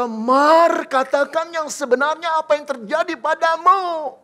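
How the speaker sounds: loud, high, urgent plea; emotional pleading. Acted. Emotional fiancé pleads loudly with Mary for truth. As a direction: grab her shoulders and beg for truth. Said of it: "dia pegang pundak Maria dan berkata".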